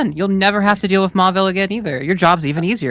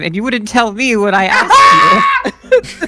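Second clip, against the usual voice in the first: slow, louder, higher mock-hurt shout; indignant outburst; 4 semitones higher, 5 dB louder, much slower than usual. Companion confronts Mary Sue about her house name, mock-hurt shout. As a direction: drag it out like a wounded friend.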